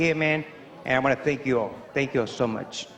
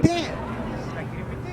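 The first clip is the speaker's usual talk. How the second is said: muttered curse; 9 semitones higher, 4 dB quieter than usual. Retold or imitated. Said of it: "And I said".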